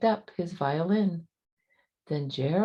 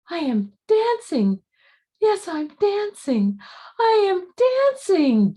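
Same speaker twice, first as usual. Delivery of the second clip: high, loud, rising cries of joy; joyful cry; 13 semitones higher, 6 dB louder than usual. A narrator's reading. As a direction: shout it out in wonder. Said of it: "Gerald cried".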